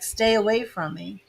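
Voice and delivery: ordinary talk, nothing marked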